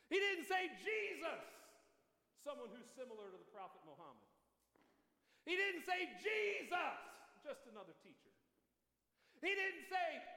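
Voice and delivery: high, loud "He didn't say, Jesus" refrains; preaching call and build. Acted. Preacher building the refrain before the congregation. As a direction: hammer the contrast, rising each time.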